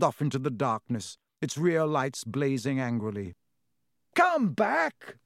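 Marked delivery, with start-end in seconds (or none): 4.1-5.2 high yell after the car. Acted. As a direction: call out after it desperately. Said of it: "Ron yelled after it".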